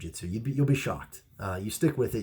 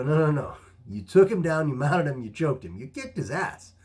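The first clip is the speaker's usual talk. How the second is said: raised, louder mock protest; playfully emphatic retort, pitched up; insistent contradiction; 6 semitones higher, 6 dB louder than usual. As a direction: argue back, hammering each point. Retold arguing back at the teacher.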